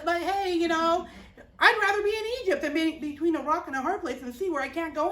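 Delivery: God's people griping at Moses, whiny yell; complaining to Moses. Acted. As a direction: gripe at Moses like a crowd of sulking kids. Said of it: "they start yelling and screaming".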